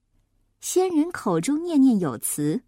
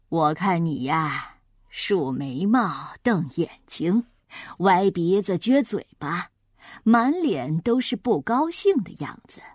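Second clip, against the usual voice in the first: put-on kindly old immortal; 6 semitones lower than usual. A narrator's reading. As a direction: take your time, reassuring a child as an old man. Old immortal reassures the boy.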